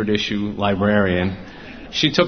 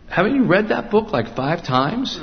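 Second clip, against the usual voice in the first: higher, drawn-out motherly tease; teasing mother; 10 semitones higher than usual. Retold or imitated. Mother in the car teasing her son, higher, slow. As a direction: tease him like his mom, stretching it out.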